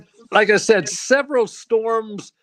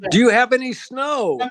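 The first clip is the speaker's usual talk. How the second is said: higher and louder, asking again; calling out loud; 5 semitones higher, 4 dB louder than usual. Real, not acted. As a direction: project the question over a bad line. Asking again, she can't hear.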